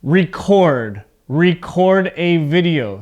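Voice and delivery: record as ree-CORD both times; record with the second syllable stronger; verb stress on second syllable. Shown as a demonstration. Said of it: "So my voice goes up".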